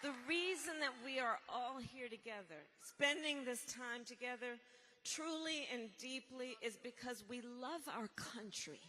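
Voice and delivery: ordinary talk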